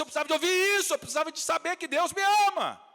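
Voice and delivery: high, loud preacher's cry; impassioned shout. Retold or imitated. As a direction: cry out her words at full preaching force. Woman's words shouted in preaching climax. (brado emocionado de pregador)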